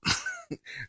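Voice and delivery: high-pitched burst of laughter; cracking up at own joke with a squeal. Real, not acted.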